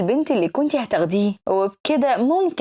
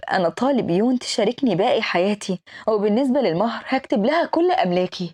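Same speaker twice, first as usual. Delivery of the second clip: plain reading voice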